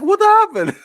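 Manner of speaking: very high, incredulous squeal; baffled outcry. Retold or imitated. Host retelling reaction to the plot gap, baffled. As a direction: re-enact bafflement with pitch shooting way up. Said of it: "I was baffled".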